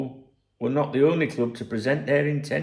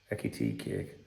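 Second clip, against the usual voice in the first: muttered joke; 11 dB quieter than usual. Real, not acted.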